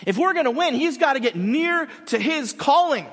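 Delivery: loud, high, forceful rebuke; stern coach scolding. Retold or imitated. Coach scolding the assistant coach, loud and stern. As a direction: insist he must be tough. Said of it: "Denzel's like".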